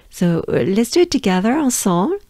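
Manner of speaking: usual speaking voice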